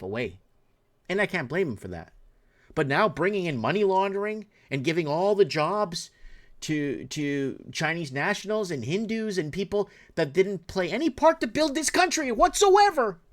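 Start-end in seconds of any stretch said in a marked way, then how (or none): 2.7-13.2 building to a high, loud furious shout; angry rant. Real, not acted. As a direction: spit out the injustice, climbing to a yell. Ranting about jobs given away.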